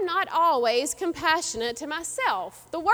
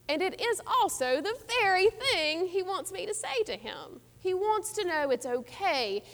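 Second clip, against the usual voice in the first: ordinary delivery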